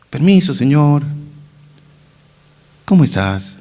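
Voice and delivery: overly composed, courteous greeting; mock polite. Preacher mimics bereaved sister greeting Jesus, mock polite. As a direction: greet politely, as if nothing happened. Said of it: "¿Ustedes creen que habló así?".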